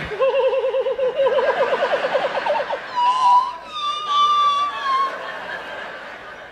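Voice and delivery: long, very high-pitched giggle; helpless squealing giggle, acted out; acted giggling. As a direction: giggle high and keep it going. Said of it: "I just started giggling".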